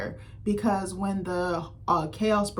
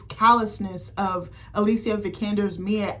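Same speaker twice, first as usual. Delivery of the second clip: usual speaking voice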